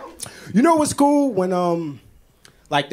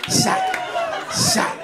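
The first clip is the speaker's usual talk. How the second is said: high squeal. Real, not acted. Squealing at candy wrapper.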